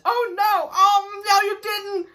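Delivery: sassy high voice, hammed-up; wife scolding in a squeaky mock voice; mock Disa. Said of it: "Disa says".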